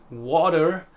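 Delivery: American "water" with a sounded final R; American accent. Shown as a demonstration. Teacher showing how an American says it, American accent. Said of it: "an American would pronounce this word".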